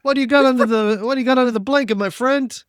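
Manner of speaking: put-on high, sly Italian wiseguy accent; Italian customs guard. Customs guard stopping a cart, sly Italian accent. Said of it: "Guy goes".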